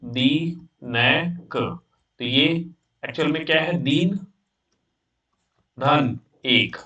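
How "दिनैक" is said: "दिनैक" stretched very slowly with a rise; drawn-out word. Shown as a demonstration. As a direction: draw the word out for the class. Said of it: "जैसे मैं लिखा दिनैक".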